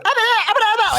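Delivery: mocking mom. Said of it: "And she'd go".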